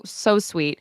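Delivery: ordinary talk, nothing marked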